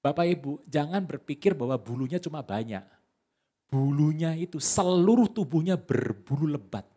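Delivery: usual speaking voice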